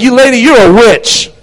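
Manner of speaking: loud, high denunciation; shouted accusation. Retold or imitated. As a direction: call her out before the whole church. Acting out rebuke of a woman.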